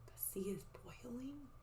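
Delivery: hushed, questioning whisper; very soft wondering aside; whispered amazement. Real, not acted. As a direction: whisper it in disbelief to listeners. Teacher's amazed aside to listeners.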